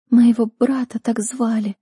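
put-on a tormented girl remembering her brother; captive girl's voice. A narrator's reading. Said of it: "послышался голос в тишине". (высокий измученный девичий голос)

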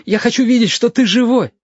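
put-on high, pleading boyish voice; worried boy begging his friend to stand; plaintive pleading. A narrator's reading. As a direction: beg him to get up, plaintively. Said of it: "жалобно просит".

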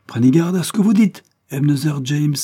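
loud, high-pitched warning; indignant. A narrator's reading. Afi interrupting Ebenezer's insinuation, indignant and loud. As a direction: cut in with heated indignation. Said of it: "interrompant avec indignation".

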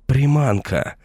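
high panicked yelp in his head; alarmed shriek. A narrator's reading. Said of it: "взвизгнуло в голове".

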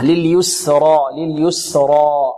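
slow recited لليسرى with thick ر; light seen, heavy raa. Shown as a demonstration. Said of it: "السين هنا كذلك مرقة والراء مفخمة". (لليسرى بسين مرققة وراء مفخمة)

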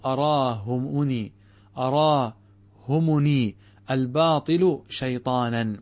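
أراهمني الباطل شيطانا read slowly and deliberately; slow recited quote. Shown as a demonstration. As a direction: recite the athar word by word. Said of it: "من قول عثمان". (تلاوة بطيئة للأثر)

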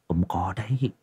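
whispered like sharing a secret; a gossip's secretive whisper. Acted. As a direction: let the drinkers in on it in a whisper. Secret about old Giang.

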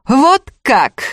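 high-pitched sneering question; mock them with feigned surprise. A narrator's reading. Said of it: "насмешливо спросила упыриха".

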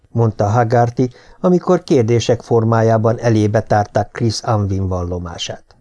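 ordinary delivery, nothing marked

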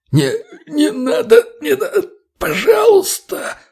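very high, slow, choked pleading; terrified squeaky whimper; strangled wheeze. A narrator's reading. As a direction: beg for your life while being throttled. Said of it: "прохрипел тот".

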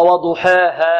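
"وضحاها" with long a leaning toward e; wrong tilted alef. Shown as a demonstration. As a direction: say "وضحاها" like "waduheha". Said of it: "نحترز من إمالة الألف".

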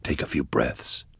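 low, hushed reassurance; soft and low, calming; whispering. A narrator's reading. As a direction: soothe them in a near whisper. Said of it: "Why are you whispering?".

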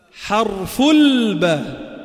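slow drawn-out letter name for students. Shown as a demonstration. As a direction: stretch out الباء slowly for the class. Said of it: "لاحظ حرف الباء تحت".

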